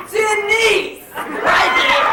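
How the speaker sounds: high loud call across the room; hammed-up shouting out; mock call out. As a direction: yell a name like a Staten Island girl. Mocking Staten Island women calling.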